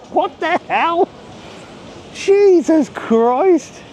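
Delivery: high, drawn-out stunned gasp of disbelief; startled by a sight while walking, exclaims in shock; shocked exclamation. Real, not acted. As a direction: react as if you can't believe your eyes. Shocked at something seen.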